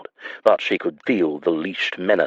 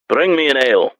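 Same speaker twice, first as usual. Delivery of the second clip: raised, rough tavern order; gruff man voice; 7 semitones higher, 4 dB louder than usual. A narrator's reading. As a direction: bark the order like a brute. Gruff villain Gregor voiced.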